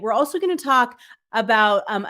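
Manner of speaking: usual speaking voice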